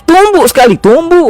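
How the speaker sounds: tearful wife. Retold or imitated. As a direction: voice the troubled wife begging for help. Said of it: "Lalu dia kata". (istri yang menangis, suara perempuan tinggi memohon)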